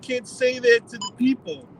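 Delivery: mock a shocked do-gooder clutching their pearls; mock outraged objector. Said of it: "people that are like".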